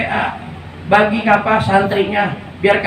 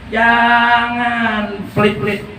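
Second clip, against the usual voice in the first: very slow, stretched-out exhortation; drawn-out urging; much slower than usual. Real, not acted. Preacher urging congregation not to be stingy, drawn-out. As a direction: stretch the first word long, almost sung.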